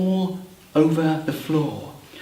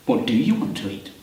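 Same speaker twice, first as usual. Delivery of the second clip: quieter question; 5 semitones lower, 5 dB quieter, faster than usual. Acted. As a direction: put the question gently to the listeners. Question turned to the audience.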